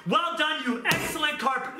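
mock praise. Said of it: "Is he gonna say that?".

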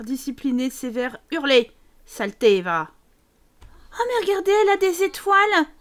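ordinary talk

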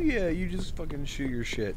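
ordinary delivery

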